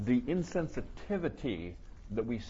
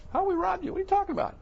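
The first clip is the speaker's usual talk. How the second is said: high, louder whiny protest; mock indignant complainer; 5 dB louder, much faster than usual. Preacher mimics Israel doubting God's love, whiny mock protest. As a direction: squeak out a petulant complaint. Mocking the people questioning God.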